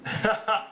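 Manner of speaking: short, high laugh; brief chuckle at one's own introduction. Real, not acted.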